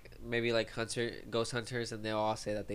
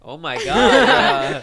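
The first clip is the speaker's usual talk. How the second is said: shrieking laugh, much higher and louder; 11 semitones higher, 15 dB louder, much slower than usual. Real, not acted. Cracking up at her friend's sad confession. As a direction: bursts out laughing, drawn out slow.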